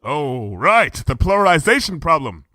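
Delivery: high regal voice, archaic speech; royal Luna voice. Acted. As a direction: speak as a grand princess. Luna realizes, decides.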